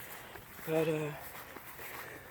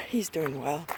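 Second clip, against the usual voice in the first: ordinary talk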